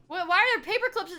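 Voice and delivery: ordinary talk, nothing marked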